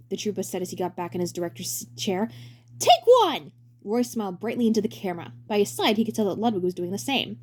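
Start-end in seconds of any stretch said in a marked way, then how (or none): 2.8-3.5 loud director call. Acted. Director calling action.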